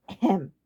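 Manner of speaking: loud fake ahem; deliberate ahem; pointed throat clear. A narrator's reading. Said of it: "Ludwig said from across the room".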